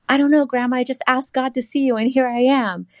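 put-on high, sheepish little-girl voice; sheepish younger self. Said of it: "And I'm like".

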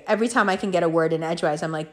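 ordinary delivery

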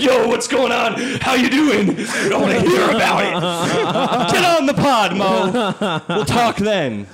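high, shouted mock enthusiasm; hammed-up eager texter. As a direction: blurt it like an overexcited buddy. Acting out friend's eager text messages.

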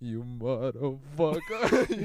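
slow and drawn out, voice shaking; shaky voice. Retold or imitated. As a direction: let the voice shake through the curse. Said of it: "make your voice shake".

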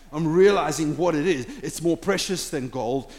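normal talking voice

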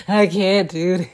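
slow, laughing mock despair. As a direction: groan it out, half laughing at yourself. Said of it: "there's moments when I'm weak".

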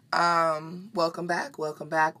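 ordinary talk, nothing marked

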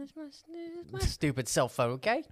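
jokingly offended squeak; playfully defensive, voice jumping high; mock indignant. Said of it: "No, I'm kidding".

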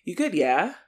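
"yeah" lifted like a question; statement as question. Retold or imitated. Imitating British people's statements, questioning lift on "yeah". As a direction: say it as a statement, tipping "yeah" upward. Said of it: "a statement but sounds like a question".

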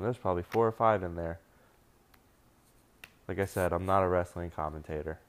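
ordinary delivery

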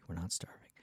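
soft whispered correction; whispered aside. Real, not acted. Whispering a correction to her own exaggeration.

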